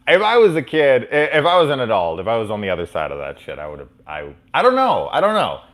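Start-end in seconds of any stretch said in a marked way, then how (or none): 4.5-5.6 much higher, louder flustered shrug. Real, not acted. Vlogger to viewers, high flustered. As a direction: throw up hands in embarrassment. Flustered about approaching celebrities.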